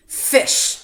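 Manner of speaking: loud, breathy grumble; grumbled. A narrator's reading. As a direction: spit the word out with disdain. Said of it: "the lobster grumbled".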